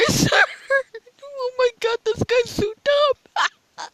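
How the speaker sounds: can't believe how dumb he is, laughing; laughing disbelief. Real, not acted. Laughing at a clueless seeker.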